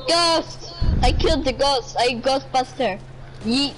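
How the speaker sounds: ordinary talk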